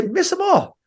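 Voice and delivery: a light send-off, like it's a fun dare; cheerful coach urging. Retold or imitated.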